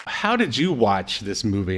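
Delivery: normal talking voice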